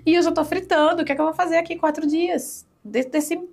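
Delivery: anxious rush. Retold or imitated. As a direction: race through it like panic is rising. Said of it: "eu acho".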